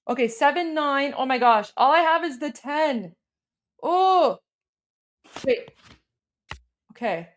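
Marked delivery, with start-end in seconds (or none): none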